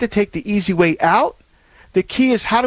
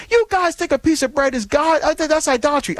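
lightly voiced scoffing critic, pitched higher; scornful critic; 7 semitones higher than usual. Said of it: "someone said".

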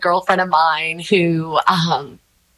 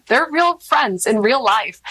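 ordinary talk

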